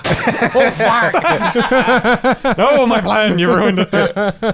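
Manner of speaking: laughing, loud and high; cracking up mid-line. Acted. NPC caught out, laughing.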